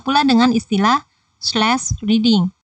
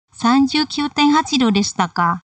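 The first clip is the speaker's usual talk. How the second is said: "Sanjukyū tenhachi do deshita" raised, then "ka" dropping; surprised falling tone; 4 semitones higher than usual. Shown as a demonstration. B responds to A's statement, mildly surprised falling intonation. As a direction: let "ka" fall in mild surprise, not as a question. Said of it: "ekspresi terkejut dari si B".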